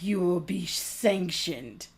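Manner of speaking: slow, low, quiet pronouncement; mock grandiose. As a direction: intone it slowly, mocking their grandiosity. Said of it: "It's that grandiose nature".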